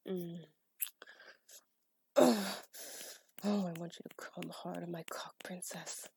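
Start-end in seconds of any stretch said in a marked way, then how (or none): 2.2-2.5 loud, sharp grunt of effort. Acted. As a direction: let out a hard grunt. Groan in the scene.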